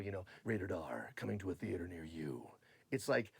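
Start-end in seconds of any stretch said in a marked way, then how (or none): 0.4-2.5 lower, softer trailer announcer read; hushed epic trailer narrator; movie trailer voice. Shown as a demonstration. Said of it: "when I do movie trailers".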